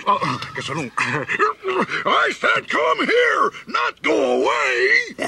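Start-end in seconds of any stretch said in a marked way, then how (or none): 2.1-5.2 exasperated yell. Acted. Weasel yells at fleeing crickets, slow and exasperated. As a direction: yell it out, fed up.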